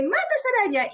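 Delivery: high urgent kill it order; high panicked demand; frightened plea. Retold or imitated. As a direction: beg him in a panic. Scared woman begging visitor.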